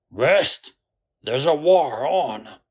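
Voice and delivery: loud, incredulous outburst. A narrator's reading. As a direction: throw it back at full volume. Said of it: "Flint said loudly".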